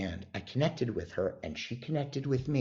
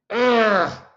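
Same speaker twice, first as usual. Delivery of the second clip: loud, high exasperated "ugh"; big frustrated groan, pitched way up; angry groan; 13 semitones higher, 12 dB louder than usual. Retold or imitated. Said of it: "I'm so angry".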